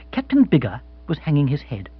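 plain reading voice

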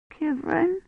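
put-on high, soft quavering child; small, timid questioning call; quavering little voice. A narrator's reading. Hiding child calls to her rescuer, quavering. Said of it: "a quavering little voice said".

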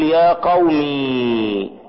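"يا قومي" drawn out high and slow like a call; calling on one's people. Shown as a demonstration. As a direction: call out "يا قومي" slowly, the ya held long. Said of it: "تنادي على قومك، تقول". (نداء ممدود "يا قومي")

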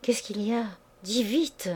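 a touch of alarm, held back; anxious stammer. A narrator's reading. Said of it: "et elle balbutia".

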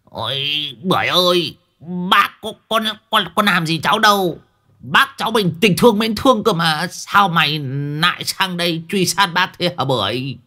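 high wailing reproach; pitiful old man's protest; plaintive scolding. A narrator's reading. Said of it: "giữa ao mà mắng".

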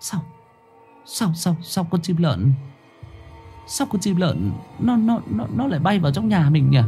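high, halting, hushed dread; alarmed stammer. A narrator's reading. Wife warning husband of an ill-omened owl, alarmed stammer. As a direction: stumble over the words in rising alarm. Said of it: "Bà Thu hốt hoảng nhận ra".

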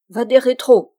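crying out. A narrator's reading. Friar finally losing patience with the spirit, crying out. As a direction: burst out at the spirit. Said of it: "s'écria-t-il enfin".

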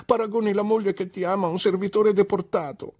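put-on high, tearful childlike wife voice; a wounded girl scolding her cruel husband; hurt young wife. A narrator's reading. Dora's wounded complaint to husband. (la moglie ferita rimprovera il marito, voce alta e lamentosa)

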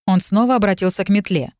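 ordinary delivery, nothing marked

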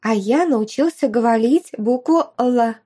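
put-on high, eager child boasting; proud little boy. A narrator's reading. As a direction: bounce up with a small child's pride. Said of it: "подскочил Май".

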